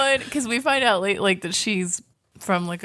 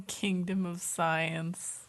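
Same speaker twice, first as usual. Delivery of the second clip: soft, drawn-out echo of a quote; hushed echo; 11 dB quieter, much slower than usual. Retold or imitated. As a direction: repeat it slowly, very quietly. Echoes the quoted promise, hushed.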